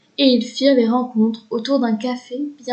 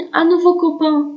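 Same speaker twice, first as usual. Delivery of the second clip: put-on higher, happy little boy voice; delighted child; 6 semitones higher, 4 dB louder than usual. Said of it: "se réjouit le bambin".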